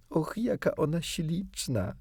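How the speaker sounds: high, slow cry of wonder; girl exclaiming over the fairy, high and slow; delighted exclamation. A narrator's reading. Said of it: "zawołała".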